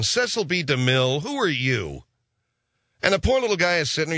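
ordinary talk

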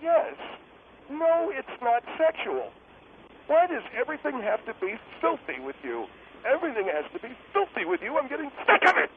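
high-pitched, indignant complaint building to fed-up; exasperated. Acted. Guest telling the host off for filthy talk, exasperated. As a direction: whine it out, thoroughly fed up.

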